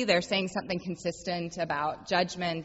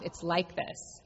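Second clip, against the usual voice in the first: saying it to oneself; 6 dB quieter, slower than usual. Shown as a demonstration. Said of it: "I just say it to myself".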